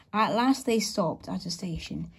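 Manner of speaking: usual speaking voice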